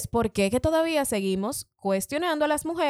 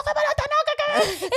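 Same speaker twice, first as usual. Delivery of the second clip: outraged disbelief. Retold or imitated. Retold outraged reply in labor.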